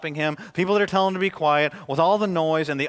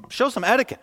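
higher, a touch of mock scolding; scolding crowd; 9 semitones higher, 4 dB louder than usual. Crowd scolds the blind man, mock scolding higher. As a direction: snip at him like a prim churchgoer. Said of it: "many rebuked him".

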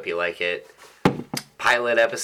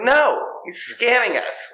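much higher, louder, slow protest; high mock shout; 6 dB louder, slower than usual. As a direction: squeal it in mock outrage.

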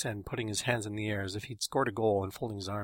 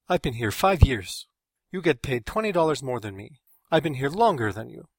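indignant; 3 semitones higher, 7 dB louder than usual. A narrator's reading. Carlos protests pay gap to coworker.

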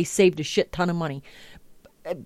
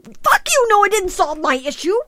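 high-pitched, loud screamed retort; furious outburst; 11 semitones higher, 4 dB louder than usual. Acted. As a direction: explode at the automated apology, voice shooting up. Answering back to refund message.

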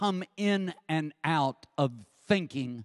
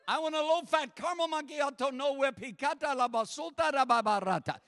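higher mock order sliding into tongues; imitating Gary in tongues; 8 semitones higher than usual. Gary at the speaker, mock tongues. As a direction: order then break into tongues. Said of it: "breaks into tongues".

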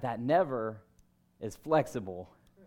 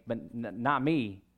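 ordinary delivery

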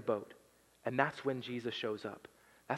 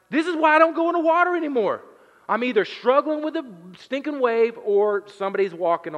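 high, loud and fast mock terror; panicked disciple; 11 semitones higher, 14 dB louder than usual. Disciple in the boat seeing a ghost, high mock panic. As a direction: squeal it like a frightened fisherman.

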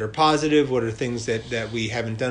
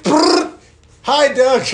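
very high, loud drive-by shout; 14 semitones higher, 11 dB louder, much slower than usual. Retold or imitated. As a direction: yell it out like a drive-by. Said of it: "and I'm like".

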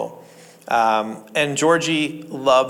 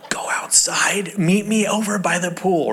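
hushed plotting whisper; secret whisper; 4 semitones higher than usual. Retold or imitated. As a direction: whisper it like a co-conspirator. Sneaking child a donut.

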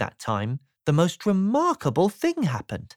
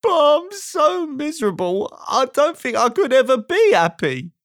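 high, squeaky, loud lament; self-pitying high-pitched moan; miserable; 9 semitones higher, 4 dB louder than usual. A narrator's reading. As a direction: wail it in a sad squeaky voice. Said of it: "he added miserably".

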